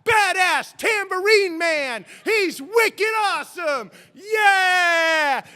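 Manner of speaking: high, slow, shouted rock-star hype; hyped announcer. Pretend. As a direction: bellow it like a radio countdown host crowning a legend. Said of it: "picturing myself on the cover of Rolling Stone magazine".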